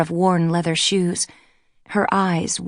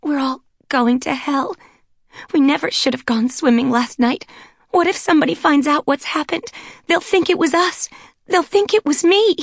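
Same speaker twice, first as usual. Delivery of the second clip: high, rising wail, close to sobbing; tearful panic; 9 semitones higher, 4 dB louder than usual. A narrator's reading. Blonde weeping that they're going to hell, panicking. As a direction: spiral into weeping terror. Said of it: "She was weeping softly".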